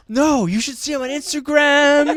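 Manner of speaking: high, loud laughing mother impression; mom voice. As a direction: laugh through the mom's line. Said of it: "this is my mom".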